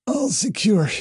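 strained, high-pitched croaky voice; hoarse croak. A narrator's reading. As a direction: croak it out, voice worn from talking. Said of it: "she croaked".

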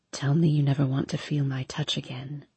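low, soft seductive whisper. A narrator's reading. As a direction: breathe it close to her ear. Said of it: "he whispers".

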